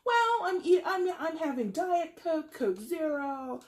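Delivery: high, squeaky mock excuse; hammed-up guilty patient voice; mock sheepish patient. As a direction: sell the diet soda like a guilty kid. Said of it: "this person is sitting in their doctor's office".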